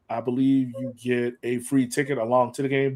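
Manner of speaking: ordinary delivery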